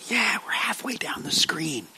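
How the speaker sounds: breathy whispered aside to the room. Real, not acted. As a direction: drop to a whisper, a quick joke. Aside to the congregation.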